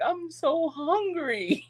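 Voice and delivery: high, very slow mock whimper; pitiful plea. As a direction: beg to be pitied. Caught sneaking food, pleading.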